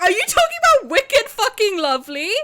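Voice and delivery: high, loud burst of disbelief; excited shout. Retold or imitated. Streamer recognising her favourite childhood book, loud excited outburst. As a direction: yell it in thrilled recognition.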